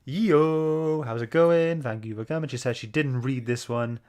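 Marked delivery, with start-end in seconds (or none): none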